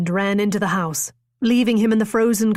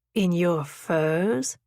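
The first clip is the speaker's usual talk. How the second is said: slow, lower, dry sarcasm; sarcastic; 3 semitones lower, much slower than usual. A narrator's reading. Jonathan doubting Alice's excuse, slow and sarcastic. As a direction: let the doubt drip slowly. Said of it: "the sarcasm in his voice".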